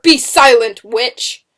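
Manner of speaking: loud, fierce silencing order; harsh command. A narrator's reading. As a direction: cut her off with a command. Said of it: "Matthew Fire commanded".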